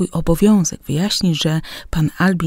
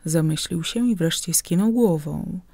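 ordinary delivery

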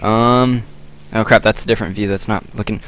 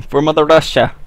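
Russian accent attempt; 6 semitones higher, 4 dB louder than usual. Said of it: "I can't do a Russian accent".

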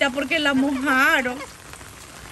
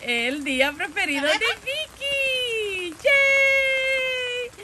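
rising into a high, long-held cheer; celebratory cheer; 9 semitones higher, slower than usual. Real, not acted. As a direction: build to a high, drawn-out yay. Cheering Vicky's favorite day.

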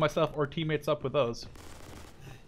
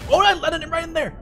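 excited; 8 dB louder than usual. Real, not acted. Grenade lands.

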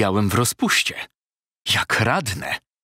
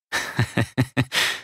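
airy breathy laugh; 3 dB quieter than usual. Acted. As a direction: laugh it off slyly. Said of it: "Zaczął śmiać się adwokat".

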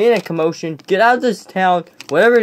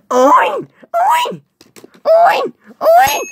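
very high squealed "oy"; short piercing squeals, one after another; goofy screaming; 4 dB louder, much slower than usual. Acted. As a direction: screech it like a silly noisemaker. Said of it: "he's gonna scream and wake up".

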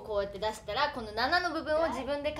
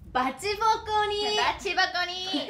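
gyaru voice; 6 semitones higher than usual. Shown as a demonstration. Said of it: "読み方がどれだけギャルかもかかってきますからね".

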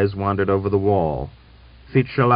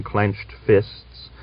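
ordinary delivery, nothing marked

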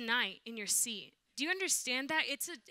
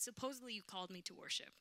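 bitter quiet complaint; 2 semitones lower, 11 dB quieter, faster than usual. Retold or imitated. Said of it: "I said".

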